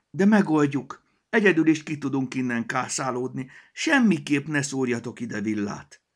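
high, loud alarmed cry; cried out. A narrator's reading. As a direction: cry out to stop them. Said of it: "kiáltotta".

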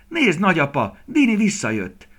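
high, loud excited call; excited shout. A narrator's reading. Small boy telling grandfather the deer is back, shouting. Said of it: "kiáltotta Flóri".

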